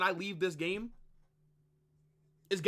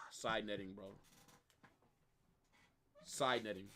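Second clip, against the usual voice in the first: much softer, sighing let-down; deflated groan; 12 semitones lower, 14 dB quieter than usual. Real, not acted. Fan watching the shot hit the side netting, sighing disappointment. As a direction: let the air out as the shot misses.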